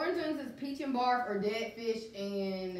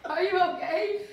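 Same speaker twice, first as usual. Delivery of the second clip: laughing; 7 semitones higher, 6 dB louder, slower than usual. Real, not acted. Laughing at Morgan's face.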